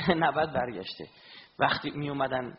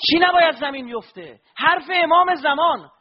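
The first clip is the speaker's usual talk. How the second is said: higher, louder impassioned sermon; fervent preaching; 12 semitones higher, 12 dB louder than usual. Acted. Preacher stirring mourners with Abbas's story, fervent and loud. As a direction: preach it out with fire to the crowd.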